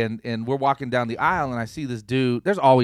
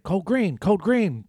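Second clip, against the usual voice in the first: raised, announcer-style call; mock intercom announcement; 7 semitones higher, much slower than usual. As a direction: mimic the store PA calling out.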